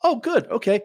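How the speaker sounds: high, mock-cheerful oh good; sarcastic chipper okay; mock relief. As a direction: fake relief before a catch. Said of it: "And I'm like".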